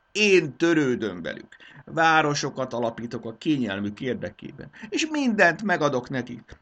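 high, loud boastful royal voice; grandiose king. Acted. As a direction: proclaim yourself their creator. King praises himself grandly.

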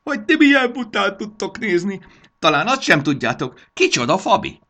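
very high, loud scoffing; mocking gnome. A narrator's reading. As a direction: ridicule them in a squeaky, loud gnome voice. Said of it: "Hangosan felnevetett". (gúnyos, nagyon magas, hangos manóhang)

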